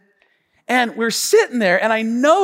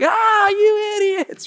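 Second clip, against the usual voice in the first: crowd jeering; 14 semitones higher, slower than usual. Retold or imitated. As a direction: jeer at the fools. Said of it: "the whole place is like".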